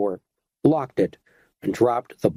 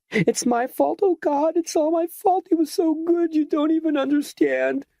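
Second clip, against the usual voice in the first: much higher, keening anguished sobbing. A narrator's reading. Esmeralda sobs that it is her fault her lover died. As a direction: wail the guilt out, barely holding on. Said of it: "she sobbed".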